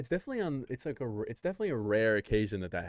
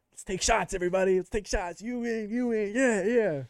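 excited; 8 semitones higher, 4 dB louder than usual. Pretend. Hyped bar guy voiced in scenario.

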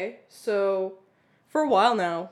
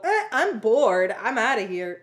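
mock bored; 6 dB louder than usual. Imagining walking out on co-host, mock bored and loud. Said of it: "I can't just get up and go".

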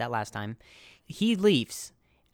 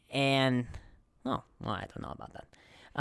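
normal talking voice

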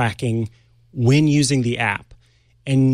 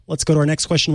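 ordinary delivery

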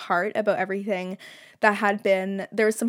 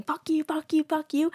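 higher, mock angry fuck-yous; retelling a fight with her brother, a higher mock yell; 7 semitones higher than usual. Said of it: "when he didn't see me yelling and pointing and saying".

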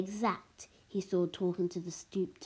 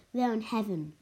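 ordinary delivery, nothing marked

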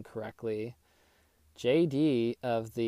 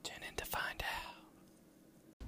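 slow, unvoiced teasing whisper; 13 dB quieter, much slower than usual. Real, not acted. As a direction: whisper it like a secret. Whispered teaser.

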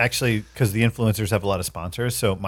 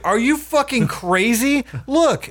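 high, slow incredulous shout; Zach incredulous; 13 semitones higher, slower than usual. Retold or imitated. Zach telling Daisy she's crazy, high incredulous voice. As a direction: spell out her madness in disbelief. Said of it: "And he's like".

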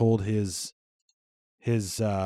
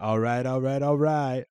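McConaughey impression; 2 semitones higher than usual. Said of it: "You don't have to quote Matthew McConaughey".

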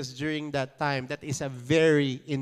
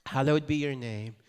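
recited prayer; 3 semitones lower, 4 dB quieter than usual. Acted. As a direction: recite it reverently, fading softer. Congregation recites the Lord's prayer.

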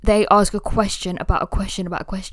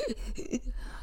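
sharp breathless gasp for air; a quick frightened intake of breath; panicked gasp; 5 semitones higher, 13 dB quieter than usual. Retold or imitated. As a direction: gasp like the air just ran out. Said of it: "I'll be like".

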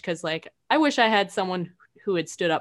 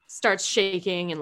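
usual speaking voice

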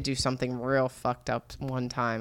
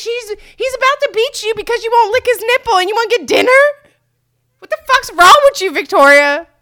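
high, loud incredulous yelling; exasperated high shouting; outraged self-scolding; 12 dB louder than usual. Acted. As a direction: shriek a hammed-up telling-off at yourself. Berating herself in outrage.

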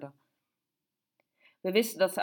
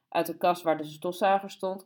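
usual speaking voice

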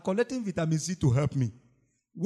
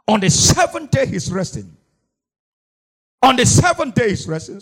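high, loud declaration to a congregation; forceful, raised-pitch sermon delivery; preaching at full force; 5 semitones higher, 7 dB louder than usual. Acted. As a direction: preach it out, hammering each key phrase.

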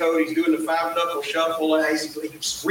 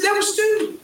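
high-pitched retold exclamation; imitated panicky reveal; mock alarm. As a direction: blurt it out much higher, as if aghast. Retold alarmed reveal after prank call.

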